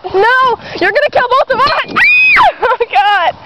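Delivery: high laughing shout. Real, not acted. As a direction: yell it between laughs.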